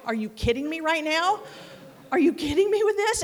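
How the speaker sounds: half-joking outrage at the glue fix; incredulous. Retold or imitated. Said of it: "I'm sitting there going".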